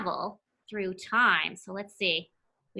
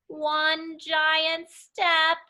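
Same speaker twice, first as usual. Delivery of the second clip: very slow, stretched out words; slowly, like a giant; slow giant step; 5 semitones higher, much slower than usual. Acted. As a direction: stretch each word like a huge stride. Reading line slowly.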